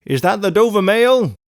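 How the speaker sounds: loud, high called question; calling from afar. A narrator's reading. Rider to the coach through mist, loud call. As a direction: call it out across a distance. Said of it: "a man's voice called from the mist".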